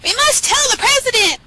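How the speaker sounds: very high hen voice; frantic cartoon chicken; clucked chicken alarm. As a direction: squawk it urgently, up high. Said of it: "clucked Chicken Licken".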